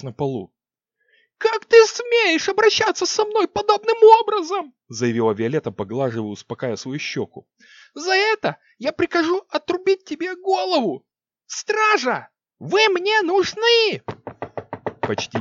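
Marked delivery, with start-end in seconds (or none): 1.4-4.7 outraged shriek. Acted. Slapped queen outraged. 7.9-14.0 shrieking command. Acted. Queen calling the guards.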